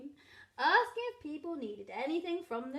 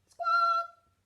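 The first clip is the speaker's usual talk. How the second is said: very high, loud parrot voice; parrot squawk; 10 dB louder than usual. A narrator's reading. Parrot asked for the shopping list, loud squawk. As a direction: let out a piercing parrot squawk. Parrot opens beak to recite.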